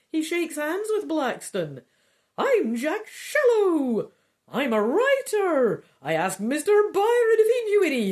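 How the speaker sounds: high, loud silly man's voice; stupid voice. Acted. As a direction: ham up a daft writer voice, pitched high. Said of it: "the one with the really stupid voice".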